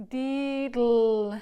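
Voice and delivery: diddle said loud and drawn out, dl sharp; a loud, slow diddle for the demo. Shown as a demonstration. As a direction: show how diddle sounds on the breath. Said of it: "So what diddle does actually is".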